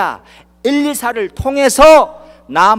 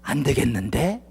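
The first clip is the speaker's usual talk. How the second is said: softer, lower hopeless aside; mock doubtful murmur; 6 semitones lower, 6 dB quieter than usual. Doubting believer muttering to himself, mock defeated. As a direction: mutter it as if giving up. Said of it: "그게 답이 아니에요".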